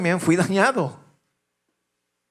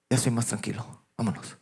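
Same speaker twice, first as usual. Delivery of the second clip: mock calm; 7 semitones lower, 8 dB quieter than usual. Father back in the car after beating a man, calmly. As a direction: lower and much softer, settled voice.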